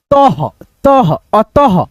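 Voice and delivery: तह and अतः slowly, ending in a puff of h; teacher's careful visarga on तह, अतः; half-H ending. Shown as a demonstration. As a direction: let अतः end on an echoed ह. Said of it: "आधा ह".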